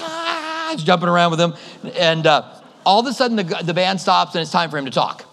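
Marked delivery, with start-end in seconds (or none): 0.0-0.7 a short high happy cry; high excited yelp. Retold or imitated. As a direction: whoop it up in the mosh pit, pitched high. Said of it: "I was like".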